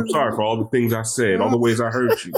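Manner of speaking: mock-soulful apology, pitched low; playful low R&B talk-over. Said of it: "I'm about to do my deep voice".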